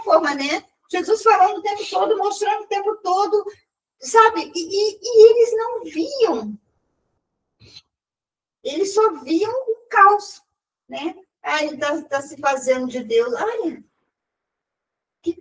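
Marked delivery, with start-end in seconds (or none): 0.9-10.4 voice breaking. Real, not acted. Emotional sermon testimony, voice breaking.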